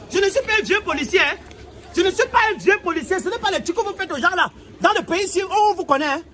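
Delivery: officer asserting his authority; indignant scolding. Acted. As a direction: tell him off forcefully. Officer scolds driver in acted quarrel.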